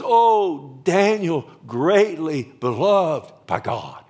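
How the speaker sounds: loud, ringing call, slowing at the end; fervent cry. Acted. Preacher voicing Gabriel's call to Daniel, loud and fervent. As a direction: call him out with heaven's force.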